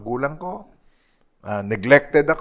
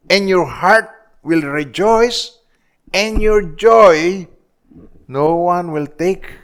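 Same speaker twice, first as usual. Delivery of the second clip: slow, rising, higher proclamation; preaching at full force; 3 semitones higher, 3 dB louder, slower than usual. Acted. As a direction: lift the promise up, slowly. Preacher proclaiming John's verse. (mataas at mabagal na pangangaral)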